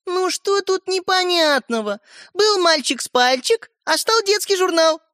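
put-on high, slow, blithe girl's voice; pleased young girl. A narrator's reading. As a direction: explain it as if obvious. Girl gleefully explains her trick. (девочка, высоким довольным голосом)

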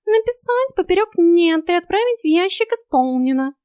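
very high, loud cat voice; dictating cat. A narrator's reading. Cat instructs heroine on the card, high dictation. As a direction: give the order high and emphatic.

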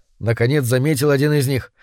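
usual speaking voice

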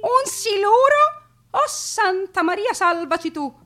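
high, alarmed cry to the Madonna; frightened passenger praying aloud; panicked woman. Acted. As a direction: beg the saint in terror.

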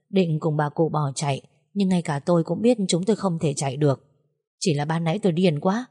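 plain reading voice

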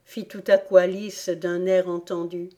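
usual speaking voice

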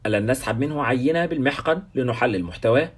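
plain reading voice

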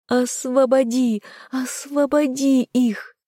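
high, slow drawn-out cries; throat-tearing yell. A narrator's reading. Heroine yelling at the fog to free captives, throat-tearing yell. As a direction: plead at a high pitch, stretching each word. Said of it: "орала я".

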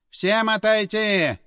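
slow, commanding leader voice; barked command; commanding order. A narrator's reading. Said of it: "скомандовал главарь".

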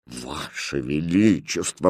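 low, slow, offended address; restrained queenly indignation; indignant. A narrator's reading. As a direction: draw out the title in reproach. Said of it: "возмутилась королева".

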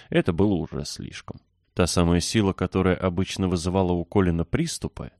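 usual speaking voice

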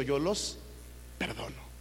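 susurrado, casi sin voz; susurro. Acted. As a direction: dilo en un susurro tierno. Jesús perdona, en voz baja. (Jesús susurrando el perdón)

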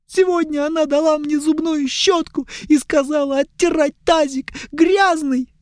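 put-on high, pleading young elf; whiny elf. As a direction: beg high and tearful.

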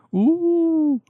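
long, high, sustained howl; dog howl. A narrator's reading. Dog shut in the dark bathroom, howling. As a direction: hold one high wolfish note. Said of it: "dolaştı daireyi uluması".

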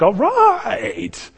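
high, drawn-out cheer; mock celebration. Fisherman imagined cheering the huge catch, mock celebration. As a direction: whoop it out, stretching the last word. Imagined wrong joyful response.

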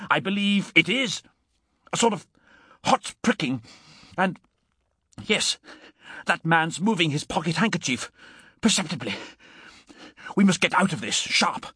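high, halting dawning alarm; alarmed cry. A narrator's reading. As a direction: realise the danger in broken bursts, ending urgent. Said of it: "he cried".

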